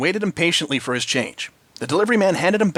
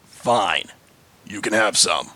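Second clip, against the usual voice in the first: low, grudging biker mumble; mumbling biker; 6 semitones lower, much slower than usual. A narrator's reading. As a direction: give in with a low grumble. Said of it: "he mumbled".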